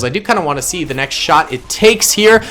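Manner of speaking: usual speaking voice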